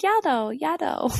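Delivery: put-on "Yellow, yellow" lisped like a toddler, "yadow"; toddler saying yellow. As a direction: mimic a toddler asking for the yellow one. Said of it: "and he's like".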